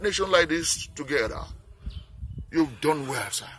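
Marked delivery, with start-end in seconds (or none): none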